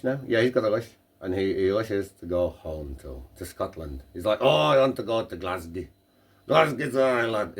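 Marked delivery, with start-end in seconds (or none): 4.4-7.6 put-on dodgy, lilting Scottish accent; joke-teller's rough Scottish voice; attempted Scottish accent. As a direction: have an unconvincing go at Scottish. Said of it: "Is that meant to be a Scottish accent".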